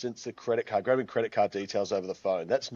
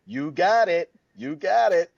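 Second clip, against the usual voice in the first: higher, louder chirpy American "You got it"; mock American accent; 9 semitones higher, 7 dB louder than usual. Australian guest imitating American waiters, bright mock American accent. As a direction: ham up an eager American server. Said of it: "with you guys, when we were there".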